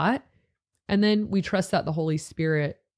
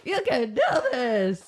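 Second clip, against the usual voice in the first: slow, laughing copy of the squeak; laughing imitation; 6 semitones higher than usual. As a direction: mimic the cracked line, giggling. Teasing repeat of the squeak.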